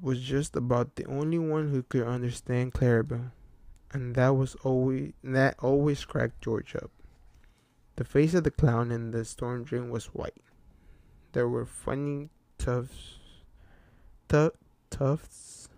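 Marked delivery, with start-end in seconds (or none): none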